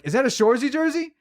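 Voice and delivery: surprised fan. Retold or imitated. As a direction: mimic a stranger's excitement. Fans asking about his jersey.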